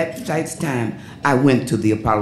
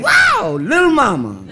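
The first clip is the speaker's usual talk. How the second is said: excited shout; 13 semitones higher, 3 dB louder than usual. Retold or imitated. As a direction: cry out in amazement at her dancing. [name] reacts to her dance.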